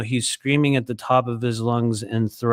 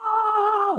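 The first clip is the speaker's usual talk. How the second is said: high imitated gasp. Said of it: "just absolute guttural, guttural screaming".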